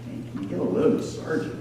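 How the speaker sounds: fast, unvoiced whisper; whispered gripe. Soldiers griping about the sergeant, fast whisper. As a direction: whisper it behind the sergeant's back. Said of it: "were like".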